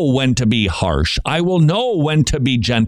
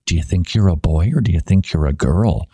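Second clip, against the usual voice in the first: lightly voiced teacher; 6 semitones lower than usual. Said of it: "three-year-olds being told".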